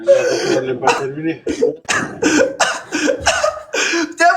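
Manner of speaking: real wordless laughter, high-pitched; long laughing fit. Real, not acted. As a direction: burst out laughing at the other debater. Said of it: "Et pourquoi tu ris?".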